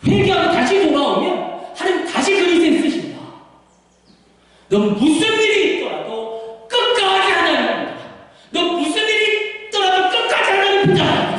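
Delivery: impassioned, high-pitched fatherly plea; David. Acted. David urging Solomon to hold on, fervent exhortation. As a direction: urge your son with full fervor.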